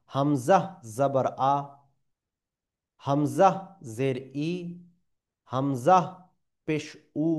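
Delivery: each vowel said slowly and deliberately; slow teaching drill, mouth opening for each vowel; tajweed letter drill. Shown as a demonstration. Teacher demonstrating hamza vowels, slow and careful. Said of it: "জাবারকে মুখটাকে খুলে".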